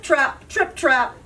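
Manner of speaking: plain reading voice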